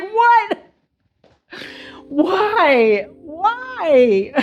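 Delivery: high, baffled disbelief; incredulous what why. Retold or imitated. Reviewer reacting to the howl scene, high and baffled. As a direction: ask why in mock horror. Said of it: "And I'm just like".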